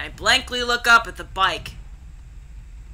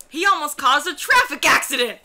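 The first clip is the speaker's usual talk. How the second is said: indignant, higher-pitched exclamation; angry outburst; 5 semitones higher than usual. Acted. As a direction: exclaim in outrage at a near miss. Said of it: "I was still angry".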